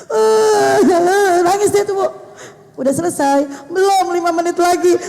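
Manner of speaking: put-on child's voice, high and loud, sobbing through it; imitating a weeping boy; crying little boy. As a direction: play the grandson crying but done. Grandmother voices crying grandson.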